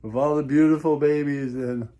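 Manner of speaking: usual speaking voice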